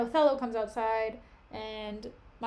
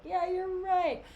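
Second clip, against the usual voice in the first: higher, eager agreement; eager Cassio; 7 semitones higher, slower than usual. Retold or imitated. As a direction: agree like a hopeful kid.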